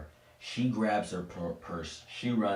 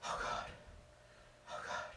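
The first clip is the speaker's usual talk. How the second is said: soft, acted whisper of dread; panicked whisper; 12 dB quieter than usual. Frightened woman alone in the bathroom, soft panicked whisper. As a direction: whisper to yourself in mock panic.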